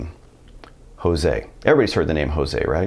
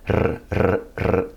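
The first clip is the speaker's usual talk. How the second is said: short rolled R trills; slower than usual. Shown as a demonstration. Teacher demonstrates tongue bouncing, rolled R trills. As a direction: separate bursts of tongue trill. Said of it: "just letting it bounce a little".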